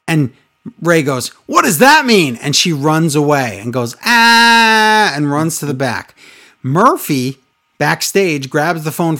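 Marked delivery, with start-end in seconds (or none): 1.5-2.4 surprised. Retold or imitated. Rey confused, quoted. 4.1-5.1 high loud cry out; mock cry. Aaliyah runs away crying out.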